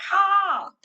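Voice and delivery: high, loud comic reveal; comic punchline. Acted. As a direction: land the joke for the kids. Punchline of the scene.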